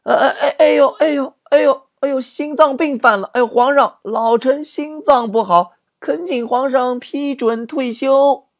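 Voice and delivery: put-on an old general overplays being sick to retire; faked heart attack. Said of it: "马上就有个将军大声说". (夸张地装病哀嚎，高声哀求退休)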